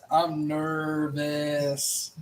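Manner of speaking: slow, drawn-out nervous groan. Real, not acted. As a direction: stretch it out anxiously.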